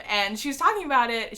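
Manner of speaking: ordinary talk, nothing marked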